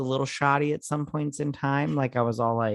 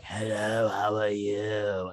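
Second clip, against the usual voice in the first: slow, lower put-on voice; 4 semitones lower, 3 dB quieter, slower than usual. Shown as a demonstration. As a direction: greet in that put-on voice, slowly. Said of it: "I wouldn't be like".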